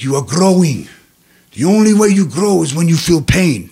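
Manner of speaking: loud, emphatic imitated African accent. African inmate teaching his chess partner, African accent. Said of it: "he talked like this".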